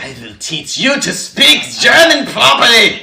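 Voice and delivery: loud, high evil German villain. Retold or imitated. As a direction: bark it like a menacing German. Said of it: "more evil".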